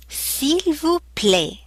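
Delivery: "s'il vous plaît" slow, high and careful; slow textbook pronunciation. Shown as a demonstration. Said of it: "I, I".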